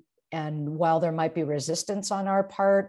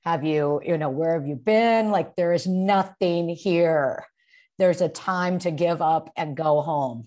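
louder, exasperated questions at the rabbi; fed up fisherman pleading, louder; ad-libbed exasperation; 5 dB louder than usual. Shown as a demonstration. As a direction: let the frustration spill out loud. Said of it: "ad lib a little bit".

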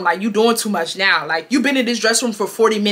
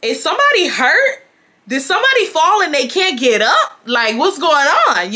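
worried; 6 semitones higher than usual. Retold or imitated. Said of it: "I'm starting to be like".

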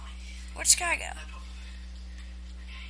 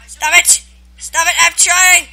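frustrated yell; 3 semitones higher, 7 dB louder than usual. Real, not acted. Being hit while playing.

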